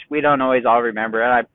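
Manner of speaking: usual speaking voice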